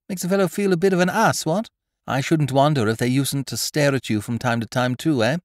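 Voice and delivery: put-on rattled young gentleman. A narrator's reading. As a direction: gabble it nervously.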